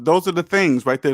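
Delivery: ordinary talk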